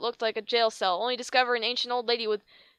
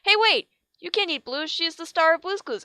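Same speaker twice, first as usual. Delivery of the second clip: alarmed protest; 7 semitones higher, 4 dB louder than usual. Real, not acted. Protesting reaction.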